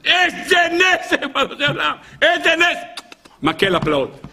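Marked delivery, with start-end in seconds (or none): 0.1-1.1 slow, stretched-out wonder, much higher; amazed. Retold or imitated. The man marvels at his magic stick, slow and awed. 2.2-2.8 excited exclaim. Retold or imitated. Amazed at the miracle.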